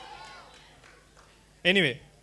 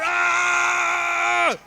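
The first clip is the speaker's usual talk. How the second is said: sudden higher shouted Ah; 6 semitones higher than usual. Retold or imitated. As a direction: let out a yelled Ah to get her attention. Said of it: "I just shouted".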